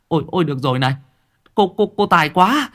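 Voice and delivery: high, loud, gushing cries of awe; awed villagers' praise. A narrator's reading. Villagers hail the woman who lifted the coffin, awed praise. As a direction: exclaim in high-pitched amazement, fawning on her.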